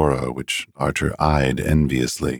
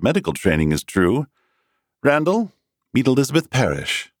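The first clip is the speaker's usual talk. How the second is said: higher, easygoing friendly voice; lightly voiced cheerful young man; Wakefield's voice; 7 semitones higher than usual. A narrator's reading. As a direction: decline lightly. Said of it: "Wakefield said".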